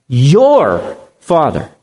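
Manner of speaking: 천천히 "Your"를 무겁게 짚어 말함; slow, heavy weight on Your; stress on your. Shown as a demonstration. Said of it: "Underline that word, your".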